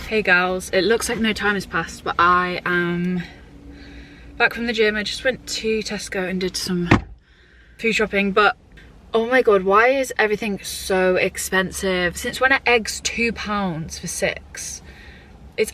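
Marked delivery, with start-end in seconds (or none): none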